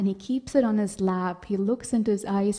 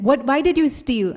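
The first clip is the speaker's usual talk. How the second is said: loud, higher-pitched demand; scolding mother; 5 semitones higher, 7 dB louder than usual. Acted. Mother confronts her son.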